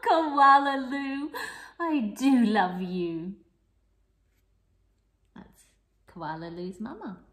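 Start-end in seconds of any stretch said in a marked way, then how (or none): 0.1-3.3 fond, slow and a little louder; loving mother. Acted. Mother koala telling her baby she loves her, fondly. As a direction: say it like a doting mum. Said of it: "laugh and shake her head and say".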